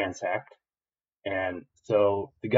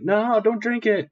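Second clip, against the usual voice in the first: high drawn-out mock protest; alarmed Kobe; 13 semitones higher, 4 dB louder than usual. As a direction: plead in a high, stretched-out voice. Voices Kobe's alarmed protest.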